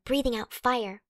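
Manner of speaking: usual speaking voice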